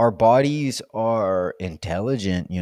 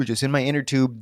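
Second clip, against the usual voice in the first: ordinary talk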